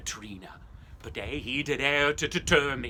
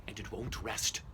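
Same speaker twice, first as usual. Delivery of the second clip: ominous whisper; 4 semitones lower, 7 dB quieter than usual. Acted. Ghost story climax.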